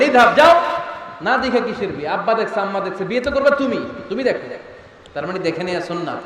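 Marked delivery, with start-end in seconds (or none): 0.0-4.5 raised, higher-pitched reprimand; scolding. Retold or imitated. Prophet telling a companion to go see the bride, scolding. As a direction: scold him into going to look. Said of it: "বিশ্বনবী ধমক দিয়ে বললেন".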